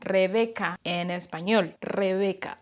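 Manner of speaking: Rebeca with a strong rolled Spanish R; Spanish pronunciation. Shown as a demonstration. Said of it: "in a very Spanish accent".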